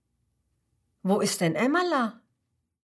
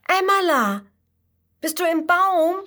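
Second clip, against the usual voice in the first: calling out much higher and louder; 10 semitones higher, 7 dB louder than usual. Acted. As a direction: shout up into the treetop. Calling up into the tree.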